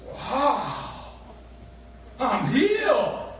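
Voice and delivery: very high, slow and loud, in a put-on voice; mock amazed Naaman. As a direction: ham up an instant miracle cure. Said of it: "and he goes".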